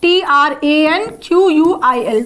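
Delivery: T R A N Q U I L spelled letter by letter. Shown as a demonstration. Teacher spells tranquil for the students. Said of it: "tranquil जो होता है".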